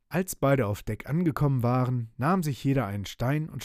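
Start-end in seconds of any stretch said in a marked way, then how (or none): none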